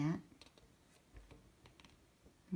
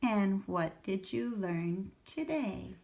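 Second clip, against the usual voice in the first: put-on reading to children; 4 semitones higher, 6 dB louder, slower than usual. A narrator's reading. Reads picture book aloud.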